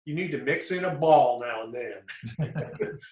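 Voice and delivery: slow, drawn-out Southern drawl. Retold or imitated. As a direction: drawl it like an old Southern pitching coach. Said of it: "with that southern drawl".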